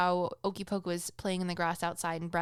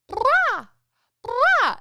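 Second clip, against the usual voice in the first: put-on much higher, louder falling baby shout; baby rapper imitation; 11 dB louder than usual. Mother imitates her baby son's favorite noise: high loud baby call. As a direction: belt it like a tiny rapper. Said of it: "he's like a little baby rapper".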